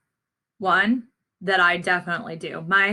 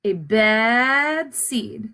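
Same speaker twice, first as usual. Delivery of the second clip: drawn-out, weighty repeat; slow ominous echo; much slower than usual. A narrator's reading. Teacher reading to children, slow ominous repeat. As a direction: stretch each word out slowly. Echoing the dark reveal.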